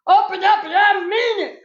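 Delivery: high, insistent yelled order; yelling. A narrator's reading. Child locked out of the bathroom, yelling. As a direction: yell it at a stuck door. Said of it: "I yelled".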